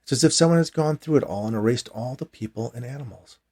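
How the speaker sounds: ordinary delivery, nothing marked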